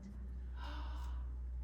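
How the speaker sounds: soft gasp. Acted. Wonder after the snowstorm.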